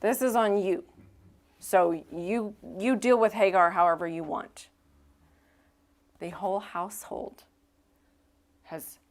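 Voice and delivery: ordinary talk, nothing marked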